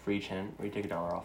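quiet repeat. Real, not acted. Repeats own offer quietly.

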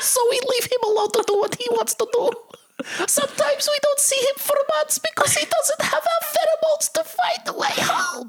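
ant voice. Pretend. Playing talking ants.

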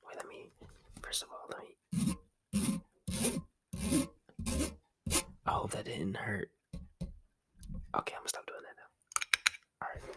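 very soft whisper close to the mic; ASMR whisper. Real, not acted. Winding down the ASMR for podcast listeners, whispering. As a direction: keep it hushed, barely voiced. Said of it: "do a little A S M R".

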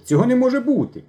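high, loud cry of disbelief; shocked high protest; alarmed cry. Acted. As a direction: refuse to believe it, crying out. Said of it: "закричав Мишко".

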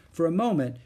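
usual speaking voice

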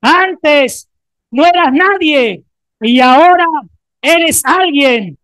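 loud and high, proclaiming to a neighbour; shouted declaration. Acted. Preacher shows what to tell the person beside you, shouting. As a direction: call it out with fervour. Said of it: "voltea de al lado y dile".

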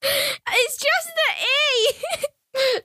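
high, giggling through the words; breaking into helpless laughter; laughing. Real, not acted. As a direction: crack up while trying to speak. Laughing fit at own reading.

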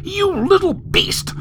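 very high, strained, drawn-out fury; furious. A narrator's reading. Mother cursing her defiant son, furious.

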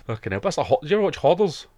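ordinary talk

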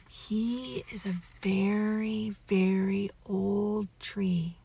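ordinary delivery, nothing marked

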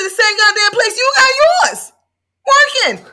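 shouted, high and loud; loud comeback. Retold or imitated. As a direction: fire it back at him loudly. Retold heated comeback to insult.

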